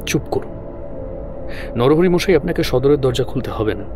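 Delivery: plain reading voice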